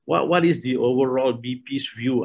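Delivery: usual speaking voice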